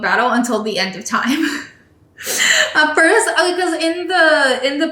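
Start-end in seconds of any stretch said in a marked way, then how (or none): 1.4-4.9 laughing through the words, higher. Real, not acted. Amused by the endless Carlos and Pierre feud, laughing. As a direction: giggle as you restart the sentence.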